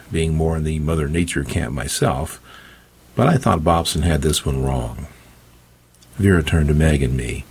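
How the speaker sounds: ordinary delivery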